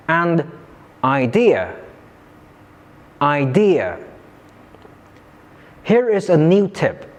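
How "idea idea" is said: "idea" with the weight on "de"; "idea" stressed on its second syllable. Shown as a demonstration. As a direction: lift and hit the middle of "idea", then fall. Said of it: "placed on the second syllable".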